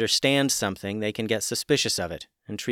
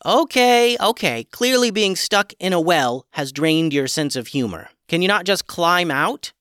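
higher, louder impatient voice; exasperated chicken; 6 semitones higher, 5 dB louder than usual. Acted. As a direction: brush it off and change topic.

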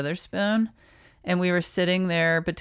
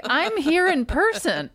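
higher, indignant protest; mock-offended, pleading for attention; 8 semitones higher than usual.

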